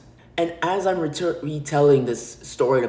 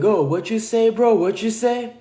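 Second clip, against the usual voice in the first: imitating a tough challenge, pitched up; mock tough guy; 7 semitones higher, 3 dB louder than usual. Guy left behind challenging the cyclist, mock tough. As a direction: puff up and challenge, higher than your own voice. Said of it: "trying to act all tough".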